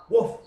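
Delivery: high, loud, short bark; dog woof. Acted. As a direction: answer with a bright quick woof. Dog answers again.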